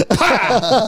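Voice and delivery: a sharp laughing spit noise; spitting sound. Retold or imitated. Storyteller mimics the dad spitting diesel, laughing.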